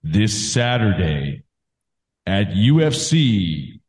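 slow, drawn-out hype announcer; wrestling promo. Pretend. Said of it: "gonna do a promo".

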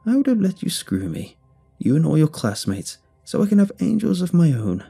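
high, gleeful hag voice; creepy hag. A narrator's reading. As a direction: relish it like a mad crone. Repeated higher, creepier.

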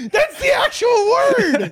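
high, slow words through laughter; laughing. Real, not acted. Laughing at a friend's word, words squeezed out through laughter. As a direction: squeal it out, cracking up.

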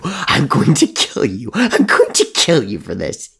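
slow, loud, high furious yell; shouted threat. Acted. Crow threatening Murdoch, slow loud furious yell. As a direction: scream the threat, dragging each word. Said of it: "he shouted".

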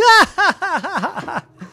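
high mock crowd laugh; hammed-up laughter. Farm friends laughing at Dora in the mud. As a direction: laugh big like the whole farm.

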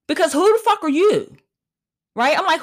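ordinary delivery

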